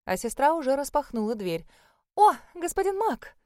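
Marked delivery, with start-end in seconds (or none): 2.1-3.4 high, bright flirty chirp. Acted. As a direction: chirp it at the handsome guest. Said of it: "прощебетала она".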